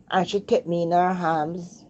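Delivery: ordinary talk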